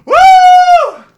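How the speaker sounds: very high, loud whoop; a real, full-throated woo; excited whoop. Real, not acted. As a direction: let out a big cheer. Cheering after the verse.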